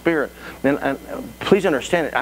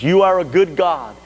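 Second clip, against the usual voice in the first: fervent praise; 7 semitones higher than usual. Shown as a demonstration. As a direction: lift the prayer into ardent declaration. Prayer rises into fervent praise.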